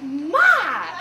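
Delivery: loud, indignant call to mother; mock scolding shout. Daughter scolds her laughing mom, shouting. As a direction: yell it in embarrassed reproach.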